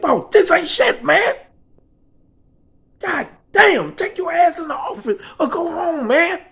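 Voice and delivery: loud, high-pitched exasperation; exasperated telling-off. Retold or imitated. Worker sending his stressed boss away, exasperated. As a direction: tell him off, fed up.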